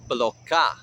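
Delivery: bloka with KA stressed and falling; second-syllable stress. Shown as a demonstration. Teacher demonstrating second rooster word, bloka stressed on KA. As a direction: land firmly on the KA of bloka. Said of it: "have an emphasis on the second syllable".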